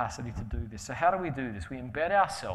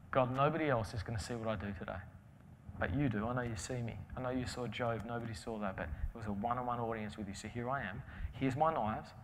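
soft, slightly lowered praying voice; private prayer; 3 semitones lower, 6 dB quieter than usual. Retold or imitated. Butcher offering his day's work to God, quiet prayer. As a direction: pray it softly, just to God.

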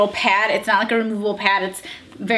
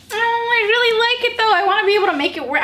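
much higher, thrilled gushing; high excited gush; 10 semitones higher, faster than usual. Real, not acted. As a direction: squeal it with real delight. Excited about swimsuit.

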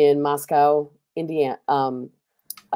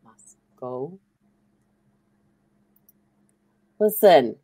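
mock police quote; 3 semitones higher, 7 dB louder than usual. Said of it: "the police came out and said".

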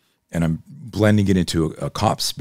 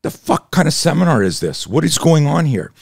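much higher and louder outraged yell of "The fuck kind of seminar is this"; hammed-up scream of an enraged student; imitating a furious woman shouting at the instructor; mock furious scream; 10 semitones higher, 6 dB louder than usual. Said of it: "she goes".

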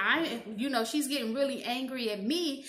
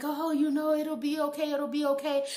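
higher, placating reassurance; mock soothing; 4 semitones higher than usual. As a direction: soothe her like a fussing child. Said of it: "trying to".

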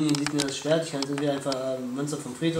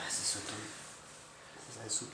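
whispering; 5 semitones lower, 17 dB quieter, faster than usual. Real, not acted. Whispered reaction.

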